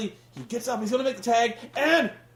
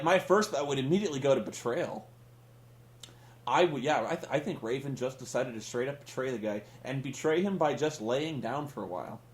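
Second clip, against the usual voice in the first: lower, soft, mock-serious reasoning; deadpan sarcasm; 6 semitones lower, 4 dB quieter than usual. Real, not acted. Host mocks the announcers' excuse, low soft deadpan. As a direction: pretend to agree, dry.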